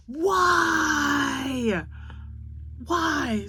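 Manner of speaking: high, loud mock wail of Why; exasperated grandma yelling Why, half playful; retold shout. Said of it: "I was like".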